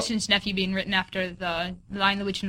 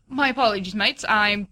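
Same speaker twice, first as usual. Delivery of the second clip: a louder, hammed-up attempt at an English accent; mock English accent; 8 dB louder than usual. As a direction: apologize in a bad English accent. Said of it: "Apologize in the accent".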